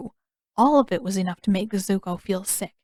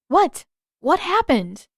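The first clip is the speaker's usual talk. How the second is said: put-on higher, louder startled girl; bewildered girl; 8 semitones higher, 5 dB louder, slower than usual. A narrator's reading. Waterbender bewildered by the news, higher and louder. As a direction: snap out a confused question.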